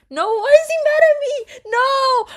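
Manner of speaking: high, loud protesting yell; outraged shout. Real, not acted. Outraged at the character.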